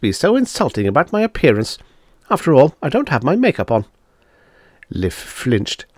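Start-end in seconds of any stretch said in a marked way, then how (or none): none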